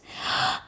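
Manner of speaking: short breathy gasp; shocked gasp. A narrator's reading. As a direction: gasp at the mud. Mud hits princess.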